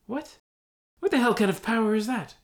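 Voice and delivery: high, loud indignant outburst; outraged disbelief. Acted. Outraged hobbit.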